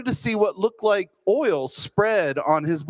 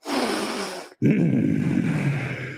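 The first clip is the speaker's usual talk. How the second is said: breathy growl; 3 semitones higher than usual. Retold or imitated. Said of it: "with breath and a growl".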